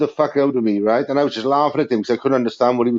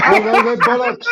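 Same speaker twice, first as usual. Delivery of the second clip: mock American saying bollocks; 8 semitones higher than usual. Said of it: "They always end up saying bullocks".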